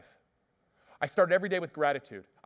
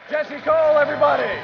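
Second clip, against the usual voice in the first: much higher, much louder announcer shout; hype shout; 11 semitones higher, 13 dB louder than usual. Real, not acted. Host shouting to the audience to cheer the speaker. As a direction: whip the crowd up for the speaker.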